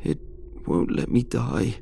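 slow, mildly wailing plea; an understated wail, words spaced out; Alex. A narrator's reading. Trapped colleague pleads through the speaker, mildly wailing and slow. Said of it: "he wailed".

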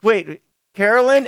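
high, startled calling of a name; suddenly recognise her, pitch up; surprised question. Retold or imitated. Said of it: "It's like".

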